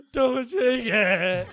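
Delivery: loud, high, drawn-out mock wail; cling to it with a slow, yelled plea; hammed-up childish desperation; desperate plea. Child begs to keep treasure.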